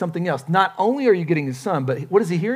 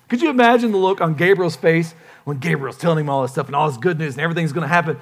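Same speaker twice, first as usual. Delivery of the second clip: normal talking voice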